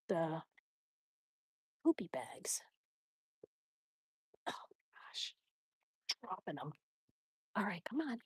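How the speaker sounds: unvoiced whisper. Real, not acted. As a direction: keep it under your breath. Whispering on walk.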